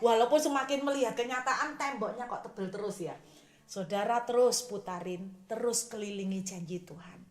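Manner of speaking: usual speaking voice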